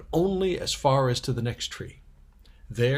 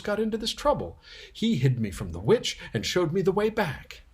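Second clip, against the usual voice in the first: put-on higher, urgent little-girl voice; Lucy insisting they save the fawn, higher; earnest young girl; 5 semitones higher than usual. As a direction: plead like a determined little sister. Lucy pleading to rescue fawn.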